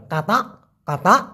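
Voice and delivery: কাতা clipped and croaked like a frog call, short rising. Shown as a demonstration. Teacher to viewers, croaking কাতা like a frog. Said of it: "ব্যাং যেরকম ডাকে ঠিক সেরকমই উচ্চারণটা হবে".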